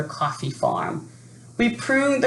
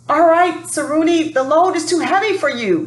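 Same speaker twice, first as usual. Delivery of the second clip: put-on much higher, louder and quicker old man's voice; Moretti's voice; 12 semitones higher, 4 dB louder than usual. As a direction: jump up high and rattle it off as old Moretti. Moretti warns the boy.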